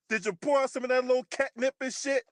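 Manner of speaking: ordinary talk, nothing marked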